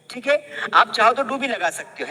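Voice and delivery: ordinary delivery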